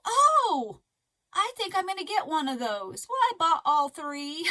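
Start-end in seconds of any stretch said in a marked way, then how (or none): 0.0-3.1 higher, louder gasp of delight at the yarn; thrilled to spot a bargain; excited find. Retold or imitated. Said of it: "I go".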